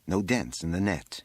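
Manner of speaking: ordinary delivery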